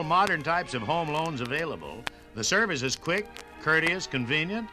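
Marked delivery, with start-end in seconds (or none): none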